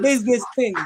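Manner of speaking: a playful Jamaican accent. Shown as a demonstration. Host demonstrates the channel name for Lando, Jamaican accent. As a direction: say it with a Jamaican lilt, falling at the end. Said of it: "give me that Jamaican accent".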